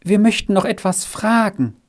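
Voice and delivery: anxious call. A narrator's reading. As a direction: ask timidly, voice lifted. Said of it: "rief es auf einmal so ängstlich".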